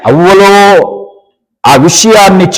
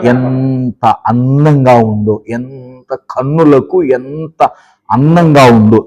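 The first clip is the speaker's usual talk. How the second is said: slow, lower, soft and marvelling; dreamy admiration; 9 semitones lower, 5 dB quieter, slower than usual. Acted. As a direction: linger over the fruit as if spellbound. Eve admiring the forbidden fruit.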